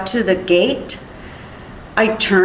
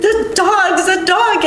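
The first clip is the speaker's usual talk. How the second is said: high, slow cry of alarm, held back in volume; retold panicked scream; 9 semitones higher than usual. Witness retelling her scream to warn her husband, high and alarmed. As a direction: re-enact a frightened warning, drawn out and high. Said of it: "I was screaming, and I was like".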